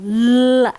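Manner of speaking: a loud, held l sound opening into la for "L"; demonstrating the sound. Shown as a demonstration. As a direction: hold the l long and loud. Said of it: "Olha o som". (som do "L" prolongado e forte)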